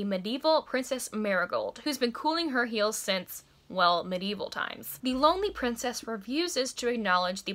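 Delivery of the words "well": ordinary talk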